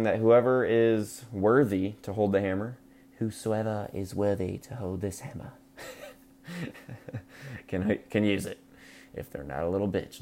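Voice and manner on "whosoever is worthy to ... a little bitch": softer, hushed and grand; hammed-up godly decree; mock solemn curse. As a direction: intone it like an ancient spell.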